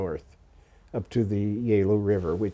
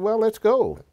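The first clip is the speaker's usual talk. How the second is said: bright, eager and much higher; 11 semitones higher, 4 dB louder than usual. Retold or imitated. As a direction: jump at the chance, keen to go. Eager reply to sergeant.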